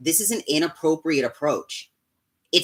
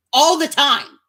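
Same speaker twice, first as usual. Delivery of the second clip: louder and higher, real frustration; angry shout; 7 semitones higher, 9 dB louder, slower than usual. Real, not acted. Ranting at critics, shouting that she errs. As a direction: blast it out at the end of the rant. Angry rant about being human.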